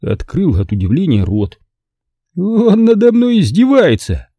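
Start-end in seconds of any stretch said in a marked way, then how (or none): 2.4-4.3 high, loud, slow nervous outburst; nervous exclamation. A narrator's reading. Carter upset that his butler mocks him, nervous. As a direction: blurt it out rattled. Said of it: "нервно воскликнул Картер".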